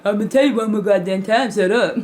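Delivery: quick threatening dad warning; fast stern warning. Retold or imitated. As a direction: warn her one last time.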